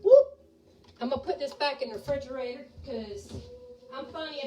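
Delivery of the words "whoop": a sudden high, loud yelp of surprise; startled whoop. Real, not acted. Cook startled at the stove, a sudden loud high whoop. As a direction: yelp as something nearly spills. Near spill while pouring milk.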